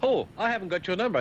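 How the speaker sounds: high-pitched mock boss complaint; imitated boss. Boss asks him for his number, high imitated voice. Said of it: "The boss said to me yesterday".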